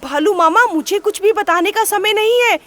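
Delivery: urgent panic. A narrator's reading. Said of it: "खरगोश तुरंत बोला".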